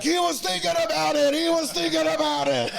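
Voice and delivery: high laughing mock Irish lad jeer; laughing taunt. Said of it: "now they're dying laughing".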